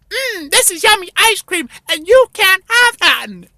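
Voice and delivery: high, mocking kid voice; high bratty child gloating over ice cream; taunting little kid. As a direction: tease him like a bratty child. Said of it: "the little kid ... going".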